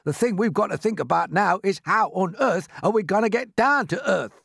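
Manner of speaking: high, exasperated character scolding; cross earthworm. Acted. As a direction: berate a chatterer in worried exasperation. Earthworm berates centipede.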